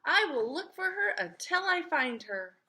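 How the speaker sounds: high, loud bird voice; Mister Bird. Acted. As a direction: voice the little bird, high and bright in pitch. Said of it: "said Mister Bird".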